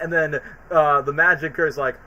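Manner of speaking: usual speaking voice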